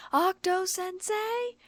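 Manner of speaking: high, slow drawn-out yell; crying out. A narrator's reading. The stalker crying out for her author through the doors. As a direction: stretch the name out in a shrill call. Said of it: "cried out again".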